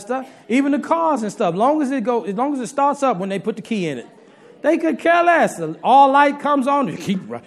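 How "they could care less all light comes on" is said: high, loud, carefree mimicry; mock carefree. Preacher mimicking wives ignoring the car, high loud carefree. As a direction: toss it off like it doesn't matter.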